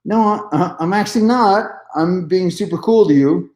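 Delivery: high, sing-song insistence he's calm; sweet protest. Retold or imitated. Acting his sweet protest.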